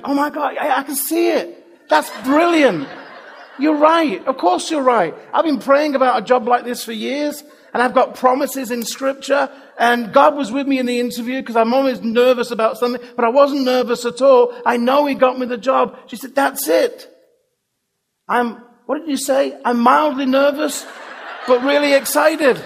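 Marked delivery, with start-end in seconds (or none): 0.0-16.0 imitating a thrilled woman, pitched up; excited woman. 16.3-22.6 excited woman. Retold or imitated. Woman excited, repeating phrase.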